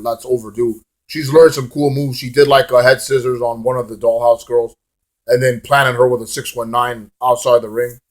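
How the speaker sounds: ordinary talk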